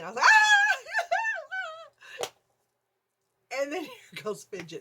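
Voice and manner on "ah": loud, very high shriek; piercing frightened shriek; re-enacted scream. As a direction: scream out in mock terror. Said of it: "then I screamed again".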